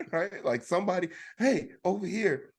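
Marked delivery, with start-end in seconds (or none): 1.4-2.5 calling out. Retold or imitated. Calling out to staff.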